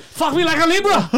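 very high, loud squeal; hammed-up performer. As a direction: squeal it like a ridiculous stage act. Mocking sex-show performer.

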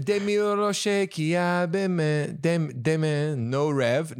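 sing-say the lyric slowly; French lyric. Shown as a demonstration.

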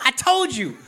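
high-pitched hammed-up shout from the water's edge; mock yelling. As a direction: play at yelling a told-you-so. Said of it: "I'd just be yelling from the".

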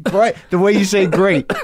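high mock Boston "great"; Boston accent. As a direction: mimic his Boston "great". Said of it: "the Boston accent".

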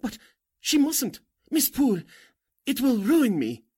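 high, loud, frantic begging; panicked outburst, near shrieking; desperate plea. Acted. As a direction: plead as if your life depends on it. Begging to stop the story.

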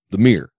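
"mirror" said as a single short syllable; one-syllable mirror. Shown as a demonstration. Joking about hometown vowels, "mirror" as one syllable. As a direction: clip "mirror" down to one syllable, local style. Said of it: "one syllable".

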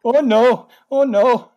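high Minnesota-accented mock dismay. Woman watching float explode, mock Minnesota dismay. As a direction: wail it high with round Minnesota O's. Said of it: "most Minnesota thing ever".

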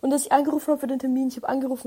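normal talking voice

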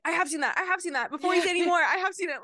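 high, loud, urgent; eager interjection. Retold or imitated. Friend mimics herself cutting in, high, loud and excited. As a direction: blurt it before they finish. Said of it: "let me interject with".

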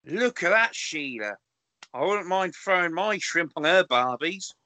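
hammed-up Aussie soap accent; Australian accent. Co-host clowning after the accent results, mock Australian accent. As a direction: put on a jokey Australian accent. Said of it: "leave Home and Away on".

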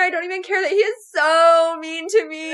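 high, loud, gushing mock infatuation; hammed-up swooning. Host voicing the lovestruck heroine, hammed-up swooning. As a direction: gush it fast and high like a lovestruck teen.

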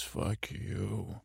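understated venom, voice sunk right down; low cold retort. Retold or imitated. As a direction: drop to a quiet, low parting shot. Walt's cold last word, retold.

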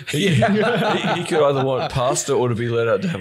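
laughing through the words; giggling while talking. Real, not acted. Laughing about Chewbacca.

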